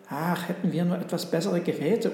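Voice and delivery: plain reading voice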